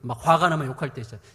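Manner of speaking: ordinary talk, nothing marked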